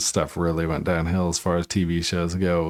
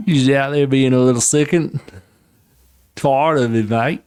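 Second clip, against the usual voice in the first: scolding the dog in a hammed-up Australian accent, pitched higher; mock Aussie rant at a whining dog; 4 semitones higher than usual. Telling off his crying dog, mock Australian accent rant. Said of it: "All right, that was bad".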